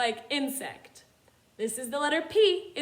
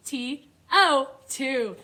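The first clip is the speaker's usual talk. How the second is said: T O two, each letter slow and apart; slow letter spelling; 4 dB louder, much slower than usual. Shown as a demonstration. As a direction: spell it out slowly for kids. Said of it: "Spell it with me".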